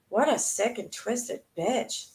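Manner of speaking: slow, appalled condemnation; slow disgusted verdict. Real, not acted. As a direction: drag it out in real disgust. Disgusted reaction to the story.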